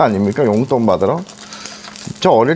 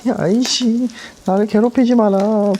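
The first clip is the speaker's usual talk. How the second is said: high-pitched complaining; 9 semitones higher than usual. Real, not acted. Pleading with hot pan.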